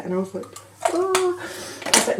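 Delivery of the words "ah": short high cry of alarm; high panicked yelp. Retold or imitated. Told to go to theatre.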